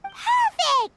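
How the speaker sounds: high, loud and delighted; thrilled high exclamation; excited. Real, not acted. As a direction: cheer the plan on. Plan comes together.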